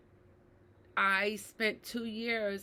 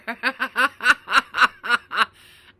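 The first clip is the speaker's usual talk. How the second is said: loud laugh; 16 dB louder than usual. Real, not acted. Mother admitting she still punished her daughter, loud laugh. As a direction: laugh out loud in a long rolling run.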